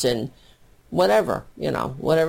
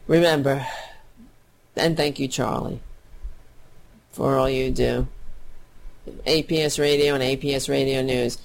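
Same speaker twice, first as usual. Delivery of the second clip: usual speaking voice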